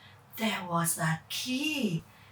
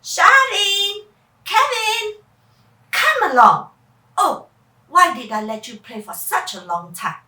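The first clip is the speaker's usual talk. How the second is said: louder, calling out; mom calling; 5 semitones higher, 8 dB louder than usual. Acted. Mom calls her children back, loudly. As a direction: call the kids over.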